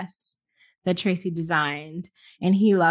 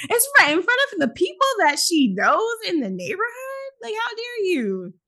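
outraged; 12 semitones higher than usual. Real, not acted. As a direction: scold in disbelief. Outrage at the remark.

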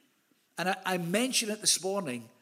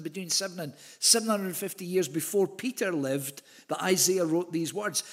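ordinary talk

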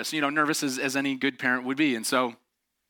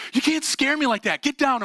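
high, imitated angry scolding; dad's fury; 11 semitones higher, 4 dB louder than usual. Dad ordering his son out of the tree, imitated anger. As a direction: order him down as the furious dad, getting louder.

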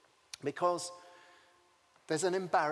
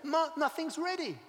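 fretting Martha; 10 semitones higher than usual. Acted. Martha fretting, self-corrected.

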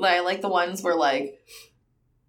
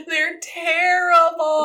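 laughing; 7 semitones higher, 4 dB louder, much slower than usual. Real, not acted. Laughing verdict on bad reenactments.

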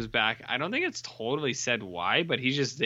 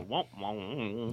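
low, soft mock "womp womp"; sad trombone noise; 3 semitones lower, 8 dB quieter than usual. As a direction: imita un trombone triste, piano e basso. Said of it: "when it happens, they go".

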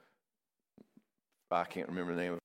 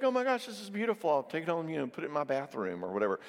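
high, fast, delighted gushing over a find; 6 semitones higher, faster than usual. Pretend. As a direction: gush like you found a treasure on the beach. Delighted finding beautiful seashell.

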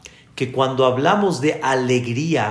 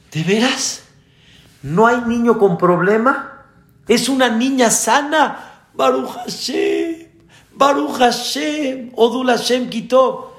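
high, amazed thanksgiving; overjoyed relief; 7 semitones higher than usual. Acted. Father learning his baby girl is healthy, overjoyed relief.

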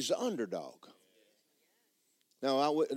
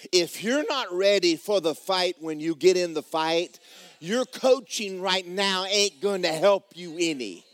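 preaching higher, louder and slow, building to a shout; full preaching; 4 semitones higher, 3 dB louder than usual. Real, not acted. Preacher driving home the lesson on practice, at full force. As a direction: hammer the point home from the pulpit. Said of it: "I'm preaching pretty good".

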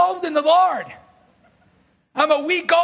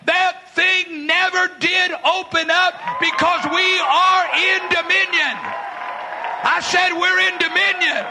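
slow, higher, shouting declaration; fiery preaching; 4 semitones higher than usual. Real, not acted. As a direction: preach it with conviction. Preaching at full force.

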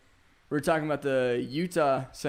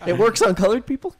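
said through a laugh, louder; a laughing punchline; 5 semitones higher, 10 dB louder than usual. Real, not acted. Friend cracks a joke mid-laugh, laughing loud.

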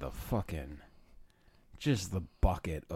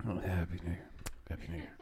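sleepy and much softer; half asleep; 3 semitones lower, 10 dB quieter, faster than usual. Retold or imitated. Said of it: "rolling over half asleep".

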